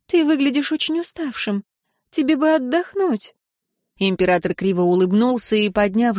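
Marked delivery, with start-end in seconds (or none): 0.1-3.3 higher, feminine voice of worry; concerned wife. A narrator's reading. Wife tells tired husband to rest, with concern. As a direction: voice a wife fretting, pitched up. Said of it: "она смотрела на него с тревогой".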